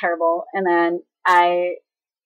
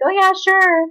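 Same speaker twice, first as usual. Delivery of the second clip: very high, loud put-on agreement; fake-agreeable grandma; 13 semitones higher, 5 dB louder, slower than usual. Grandma to dad handing over a note, fake-agreeable. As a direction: imitate grandma sweetly playing along.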